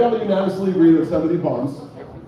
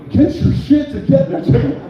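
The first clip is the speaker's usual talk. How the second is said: high, loud mock scolding; mock yell; 9 semitones higher, 4 dB louder, slower than usual. Host mock-yelling at comedian Jim, playful loud scold. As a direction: bark it at Jim in jest.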